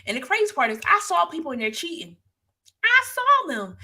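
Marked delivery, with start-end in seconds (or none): 2.8-3.8 indignant emphasis. Real, not acted. Stressing witnessing cheating.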